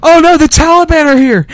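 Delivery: hammed-up terror at an imagined raid; mock panicked scream. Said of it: "somebody would have been screaming".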